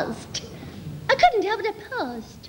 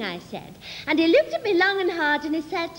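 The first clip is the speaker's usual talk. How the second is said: normal talking voice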